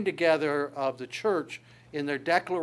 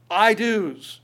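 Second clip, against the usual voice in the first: loud, raised proclamation; roaring declaration; 5 semitones higher, 8 dB louder than usual. Retold or imitated. Preacher voicing the church's vows from heaven, loud declaration. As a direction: ring it out like a crowd's vow. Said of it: "it literally is a roar".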